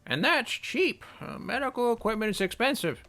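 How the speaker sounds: put-on high, slow old woman voice; a knowing old lady explaining prices. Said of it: "we can do an old lady voice".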